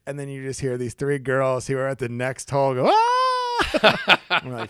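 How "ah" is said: very high, loud mock shriek; sudden hammed-up scream; imitated girls' scream. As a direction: squeal like startled girls. Imitates three girls screaming at snake.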